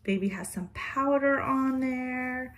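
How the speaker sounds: normal talking voice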